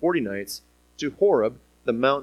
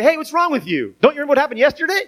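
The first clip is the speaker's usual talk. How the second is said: high, loud, fast exasperated rebuke; hammed-up frustrated scolding; mock scolding; 11 semitones higher, 6 dB louder, faster than usual. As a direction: scold like a fed-up parent. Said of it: "slap him upside the head and say".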